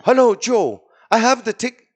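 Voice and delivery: mother-in-law's message. Retold or imitated. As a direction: sing out the greeting like her. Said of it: "It says".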